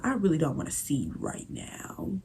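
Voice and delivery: unvoiced whisper; whispered aside. Real, not acted. Muttering to naked strangers in the changing room, whispered. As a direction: whisper it like a secret.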